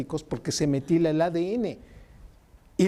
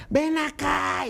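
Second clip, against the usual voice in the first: stern parent call; 9 semitones higher, much slower than usual. Retold or imitated. Parent calling teen over.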